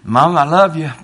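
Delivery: high, loud exultant cry; triumphant shout. Acted. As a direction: crow it out in triumph. Duck's farewell shout.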